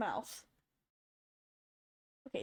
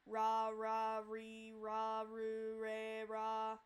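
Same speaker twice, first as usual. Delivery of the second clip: "ra, re, ru" repeated much softer with an English R; softer wrong R; 5 dB quieter, much slower than usual. Shown as a demonstration. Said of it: "You don't wanna do that".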